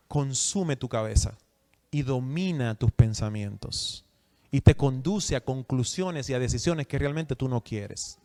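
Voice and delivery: usual speaking voice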